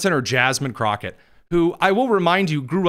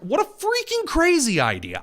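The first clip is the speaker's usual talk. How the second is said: high-pitched mock astonishment; sarcastic disbelief; 12 semitones higher than usual. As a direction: feign shock at an outlandish notion. Sarcastic mock outrage at own view.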